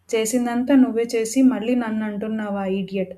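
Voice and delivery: ordinary delivery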